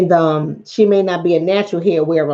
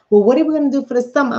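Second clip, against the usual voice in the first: higher, quick mock-eager question; imitating eager planners; 7 semitones higher, faster than usual. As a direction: mimic the moms rushing into summer plans.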